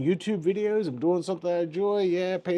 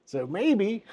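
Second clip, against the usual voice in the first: ordinary talk, nothing marked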